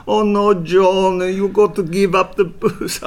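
high, loud, slow mock German wife; hammed-up German accent, high and pleading. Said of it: "John would impersonate ... Johnny's first wife, Marianne, who was German".

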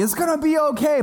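high-pitched quote of mother; mom reassuring. Retold or imitated. Preacher quoting his mom's comfort, high reassuring voice. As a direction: lightly imitate a reassuring mom. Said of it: "my mom was telling me".

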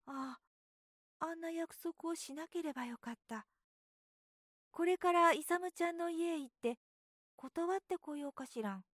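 put-on a child regretting his promise; fretting little boy. A narrator's reading.

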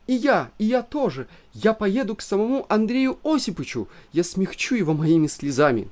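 high, whining wife's voice. A narrator's reading. Wife whines she will go too, tearful. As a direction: whine on the edge of tears. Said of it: "заныла Елена Ивановна".

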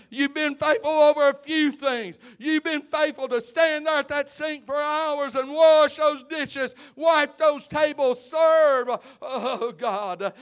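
loud, high shouted list of chores; preaching at full force. Acted. Preacher honouring kitchen and cleaning workers, full-force shouting. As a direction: hammer each task home.

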